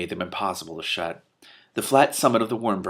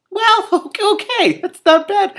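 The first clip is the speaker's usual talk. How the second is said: much higher and louder through a laugh; amused outburst at the logic; laughing aside; 10 dB louder, slower than usual. Real, not acted. Reader laughing at Two Flower's reasoning, higher and louder.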